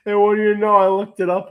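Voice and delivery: giggling through the words; laughing. Real, not acted. Laughing at own gross story.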